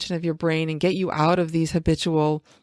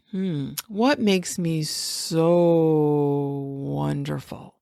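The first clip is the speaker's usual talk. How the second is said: very slow, every vowel stretched long; slow musing question with long drawn vowels; dragged-out vowels; 3 semitones lower, much slower than usual. Shown as a demonstration. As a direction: draw each vowel out like taffy. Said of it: "really, like, drag out the vowels".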